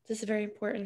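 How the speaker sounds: soft pet talk. Real, not acted. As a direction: gently tell the cat off. Telling cat about interview.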